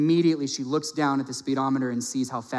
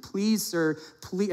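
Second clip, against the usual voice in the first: imitated begging; 6 semitones higher, slower than usual. College girl begs judge not to jail her, pleading. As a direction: plead high as the desperate girl. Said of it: "I mean, she is begging".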